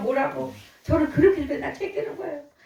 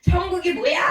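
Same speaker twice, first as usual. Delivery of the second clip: mock shout; 7 semitones higher than usual. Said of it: "소리를 지르시고".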